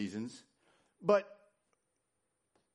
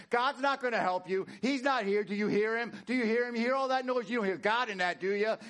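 high, insistent taunting; the devil's raised, badgering voice; shouting devil; 10 semitones higher, 5 dB louder than usual. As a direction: hammer the questions without a breath. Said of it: "He's shouting things like".

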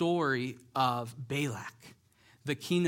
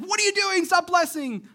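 furious king; 9 dB louder than usual. Retold or imitated. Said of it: "Balak's like".

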